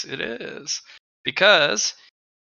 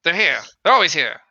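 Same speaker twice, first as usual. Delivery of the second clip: paranoid creature voice; 3 semitones higher, 4 dB louder than usual. Acted. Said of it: "But it's like".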